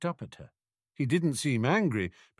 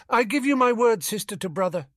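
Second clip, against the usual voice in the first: put-on higher girl's voice, firm; Ginny promising; 12 semitones higher, 5 dB louder than usual. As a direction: swear it earnestly. Ginny giving her word.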